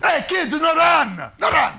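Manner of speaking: put-on Italian accent, shoo the kids then serve. Mister Paretta shooing kids in the pizzeria, Italian accent.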